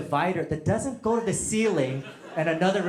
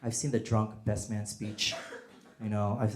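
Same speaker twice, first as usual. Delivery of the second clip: normal talking voice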